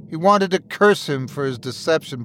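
ordinary delivery, nothing marked

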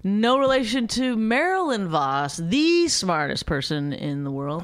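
slow, emphatic stage introduction; mock announcer. Wife introducing husband as a genius, mock announcer. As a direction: proclaim it grandly with stretched stresses.

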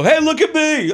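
high, sing-song mock call; playful call. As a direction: call out to her like a goofy prankster. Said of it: "I go".

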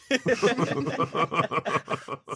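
a high, breathy burst of laughing; high laughter. Real, not acted. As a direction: laugh freely at the joke. Laughing together.

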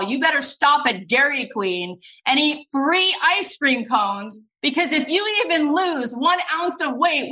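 high, forceful mock orders; hammed-up bossy director laying down the law; insistent commands, pitch climbing high as they build; Steven Spielberg. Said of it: "And he goes".

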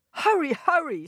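high, urgent hurrying call; urgent owl. A narrator's reading. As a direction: hurry them along with alarm. Owl urges haste before hunter comes.

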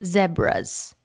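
"Zebras" with a short e, "zeb-ruhs"; South African zebras. Retold or imitated. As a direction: clip the first vowel of "Zebras" like "web". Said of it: "In South African accent".

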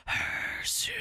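drawn-out, voiceless Parseltongue whisper; imitated eerie whisper. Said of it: "he whispers Harry".

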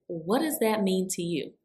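ordinary talk, nothing marked

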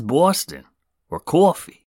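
exaggerated Boston accent, closer to Long Island or Jersey; mock Boston accent. Podcaster reads out a listener's complaint, mock Boston accent. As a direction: stretch "Boston" and "coffee" in a botched Boston accent. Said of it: "That's more like a Long Island or Jersey accent".

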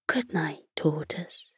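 whispered gently, almost silent; soft goodnight whisper. A narrator's reading. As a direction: tuck them in with a soft whisper. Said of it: "In a soft voice".

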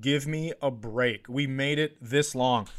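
ordinary talk, nothing marked

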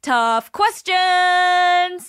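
big booming ring announcer, stretched out; boxing match announcer. Acted. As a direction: hype up the segment like a title fight. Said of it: "I'm adding, like, an announcer voice".

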